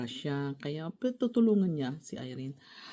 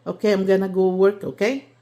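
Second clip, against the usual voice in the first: loud to mother; 9 dB louder than usual. Real, not acted. Daughter tells her mother she's leaving for work, loud and clear. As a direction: call it out so she hears. Telling elderly mother loudly.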